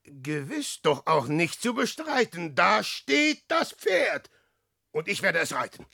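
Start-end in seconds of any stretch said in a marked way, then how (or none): 2.5-4.3 high, slow, emphatic announcement; raised voice, drawn-out words; triumphant exclamation. Acted. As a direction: proclaim it slowly and triumphantly. Triumphant at finding the horse.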